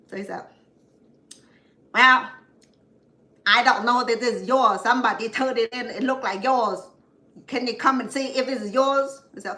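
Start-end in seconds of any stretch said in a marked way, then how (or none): 1.9-9.2 higher, louder, blunt big-brother voice; imitating older brother. Older brother calling his sister about her loss, blunt and loud. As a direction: bark it like a gruff older brother. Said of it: "that's the way he talked".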